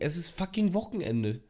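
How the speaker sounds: ordinary delivery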